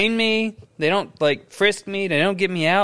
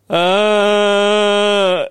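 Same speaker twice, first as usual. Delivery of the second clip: mock dying groan; 3 dB louder than usual. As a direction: groan like a bad actor playing wounded. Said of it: "He just keeps going".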